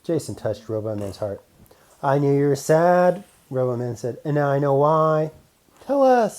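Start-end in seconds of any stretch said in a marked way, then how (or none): 2.0-3.2 robot man voice. Acted. 4.2-5.3 robot man voice. Acted. 5.9-6.4 high, loud eager plea; eager robots. Acted. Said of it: "said Stellar and Oops".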